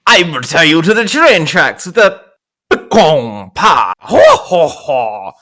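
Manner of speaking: slow, hammy vaudeville villain with a chuckle; mustache twirling villain. Acted. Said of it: "trying to twirl my mustache up and go".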